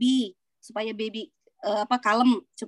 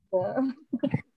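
soft, brief chuckle; small self-conscious laugh; short laugh; 4 dB quieter than usual. Real, not acted. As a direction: chuckle lightly to close the story. Laughs after sharing own story.

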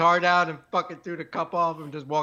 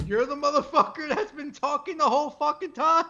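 retold heckler; 7 semitones higher than usual. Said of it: "he stood up, he goes".

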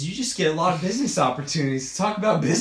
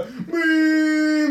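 high, loud shouted boom; mock boom shout; 13 semitones higher, 5 dB louder than usual. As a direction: yell the intro sound like a blast. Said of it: "I just hear a".

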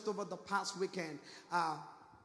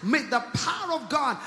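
very loud, driving sermon delivery; full-force preaching; 3 semitones higher, 15 dB louder than usual. Real, not acted. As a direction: build to a shouted, emphatic warning to the congregation.

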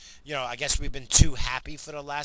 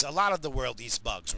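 ordinary delivery

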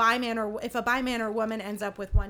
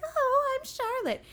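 put-on high girly Charlotte; 12 semitones higher, slower than usual. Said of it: "And she's like".

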